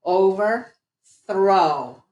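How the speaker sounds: very slow, drawn-out "Overthrow"; very slow model. Shown as a demonstration. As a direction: stretch "Overthrow" fully. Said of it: "this is a compound word".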